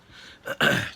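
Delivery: loud throat clear. Real, not acted. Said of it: "clear my throat a bit".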